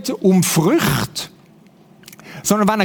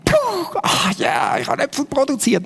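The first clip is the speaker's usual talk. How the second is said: high puff, then spent relief; relieved straining tree; 5 semitones higher than usual. Pretend. Preacher plays a tree done bearing fruit, mock relief. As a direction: play a tree exhausted after pushing.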